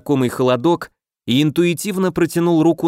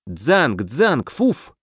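sword clash sounds; much slower than usual. Acted.

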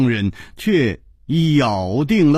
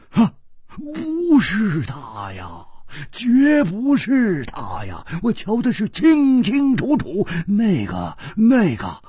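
crying out; 7 semitones higher than usual. Acted. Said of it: "胡铁花叫起来说".

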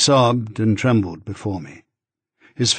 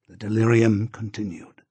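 plain reading voice

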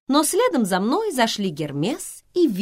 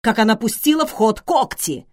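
higher, louder plaintive protest; complaining; 8 semitones higher, 8 dB louder than usual. A narrator's reading. Schweiger tells how the muse clawed him, complaining. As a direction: pour out a grievance. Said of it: "пожаловался Швайгер".

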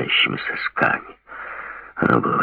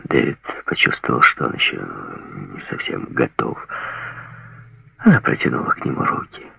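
usual speaking voice

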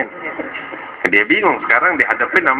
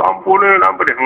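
high mock-crying plea; tearful begging; 8 semitones higher than usual. Boy caught a third time begs forgiveness, acted crying. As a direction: whine for forgiveness like a caught child. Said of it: "Dia bocor nangis".

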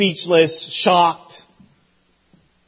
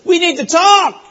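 firm Peter; 9 semitones higher than usual. Retold or imitated. Peter grabs Jesus shoulder.

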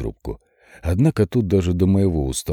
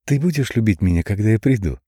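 put-on creepy child; 4 semitones higher than usual. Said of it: "ко мне обращалась маленькая девочка".

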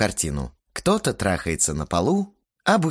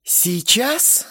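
higher, drawn-out rising question; 14 semitones higher than usual. Acted. Asya drawls back at the hero, drawn out and high. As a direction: stretch the word out, teasing. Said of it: "протянула Ася".

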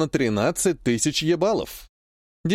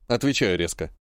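ordinary delivery

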